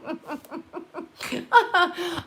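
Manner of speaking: high, trailing laugh. Real, not acted. Telling viewers about relatives, a high trailing laugh.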